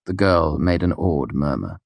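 ordinary delivery

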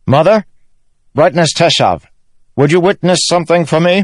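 loud, high call across a chamber. A narrator's reading. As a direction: call out formally to those below. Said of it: "called down to those at the bottom".

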